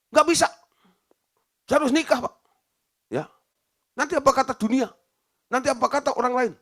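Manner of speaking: high, loud, upset protest; angry outburst. Retold or imitated. Daughter angrily refuses the pastor's advice, high and loud. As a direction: push back hard, almost shouting. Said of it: "Anak itu marah".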